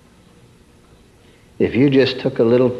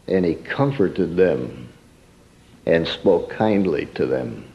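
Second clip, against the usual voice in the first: gently; 6 semitones lower, 4 dB quieter than usual. Acted. Gentle reading of Joseph's kindness.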